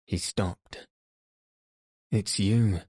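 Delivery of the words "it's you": a mildly feverish outburst; feverish cry. A narrator's reading. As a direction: blurt it out, a touch feverish. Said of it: "he cried in a feverish tone".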